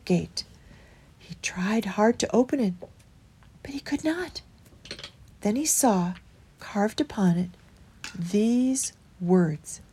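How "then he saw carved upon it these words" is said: usual speaking voice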